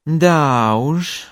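drawn-out, emotive "Да уж"; expressive lengthened vowel. Shown as a demonstration. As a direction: stretch "Да" knowingly before "уж". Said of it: "used to express certain emotions".

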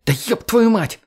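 high, strained shriek of effort. Acted. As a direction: squeal it out under strain. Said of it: "взвизгнула девушка".

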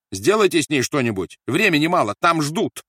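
high, loud, impatient demand; urgent order. A narrator's reading. Officer urges his aide to revive the prisoner, urgent order. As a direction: push the aide to hurry.

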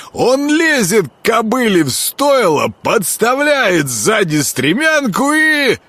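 high, slow, relishing peasant gossip; gossiping miller. A narrator's reading. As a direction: savour every dirty detail.

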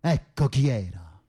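high, slow furious cry; angry outburst. Acted. Cellmate bursts out in anger at the priest's praise. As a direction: spit the words out in rage.

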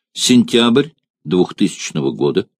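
usual speaking voice

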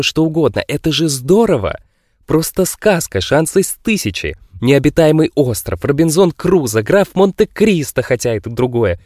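high, loud, thrilled rambling; excited eccentric. Acted. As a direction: gush about adventure like a delighted kid. Genius thrilled about the wild.

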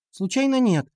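put-on high-pitched girlish answer; girl's retort. A narrator's reading. Катя answers the boy's request, high-pitched retort. Katya's curt reply in dialogue.

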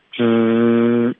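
之 held long with curled tongue and pushed-out lips; pouted retroflex. Shown as a demonstration. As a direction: pout and sustain a blurred "dr" for 之. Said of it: "可是同时要嘟嘴". (卷舌嘟嘴拖长 之)

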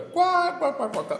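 high, loud mock cackle of quá quá; hammed-up laugh in Débora's voice; imitated loud cackle. Pastor mimics Débora laughing at the exact recipe, loud cackle.

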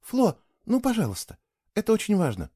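put-on high, coaxing girl's voice; pleading. A narrator's reading. Girl begging the boy to stay, pleading. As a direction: plead with him gently.

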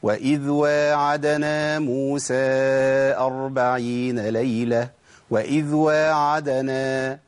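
slow tajweed with واعدنا read with a long aa; correct recitation. Shown as a demonstration. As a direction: chant it slowly, stretching واعدنا. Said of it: "اسمعوا كده".

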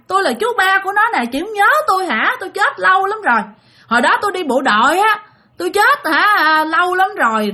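high-pitched, plaintive retold lament; mock tearful lament. Great-uncle's spirit complains to the family he is forgotten, mock tearful. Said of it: "Ta nói nước mắt, nước mũi tè le".

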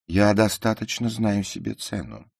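high, loud self-important retort; proud. A narrator's reading. Ruth answers the narrator's teasing about herself, proud. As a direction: assert your worth haughtily. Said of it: "гордо вскинув голову".

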